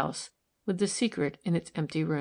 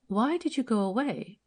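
lightly voiced tender nudge; gentle repeat; 5 semitones higher than usual. A narrator's reading. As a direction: ask again kindly, coaxing him out of his thoughts. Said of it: "she repeated gently".

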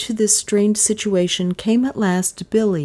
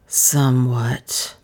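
dazed, slow answer, pitched down; 4 semitones lower, much slower than usual. A narrator's reading. Dazed stranger answers the mistress, very slowly. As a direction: drag the word out slowly. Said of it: "His words still came very slowly".

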